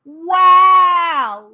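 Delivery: loud high gasp of disbelief; a real, loud shocked wow; shocked exclamation. Real, not acted. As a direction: blurt out a stunned wow. Reacting to document.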